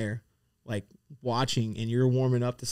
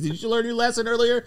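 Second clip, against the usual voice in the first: teasing high voice; 10 semitones higher, 4 dB louder than usual. Pretend.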